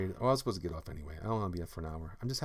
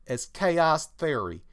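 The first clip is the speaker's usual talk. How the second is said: reading in a voice; 4 semitones higher, 7 dB louder than usual. Acted. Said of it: "reading that in that voice".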